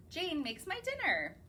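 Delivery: plain reading voice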